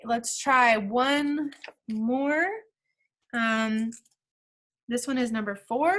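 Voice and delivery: ordinary talk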